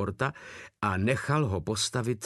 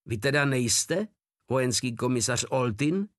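plain reading voice

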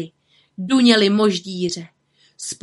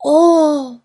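high, much louder startled "Ó"; startled gasp; 8 semitones higher, 8 dB louder than usual. Acted. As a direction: cry out in sudden alarm. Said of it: "ustrnul Tomáš".

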